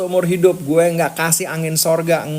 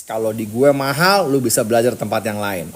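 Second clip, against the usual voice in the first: ordinary talk, nothing marked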